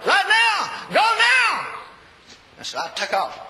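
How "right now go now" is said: shouted high and loud, urgent; booming command. Retold or imitated. Preacher shouting God's order to go now, booming.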